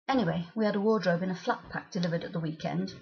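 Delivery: usual speaking voice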